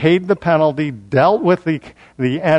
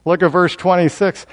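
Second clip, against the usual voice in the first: ordinary talk, nothing marked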